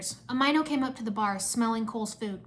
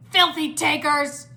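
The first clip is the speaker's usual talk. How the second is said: raised, slow, heavy insult; angry shout; 5 semitones higher, 7 dB louder, slower than usual. A narrator's reading. Monk insults the geckos back, angry shout. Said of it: "a monk shouted".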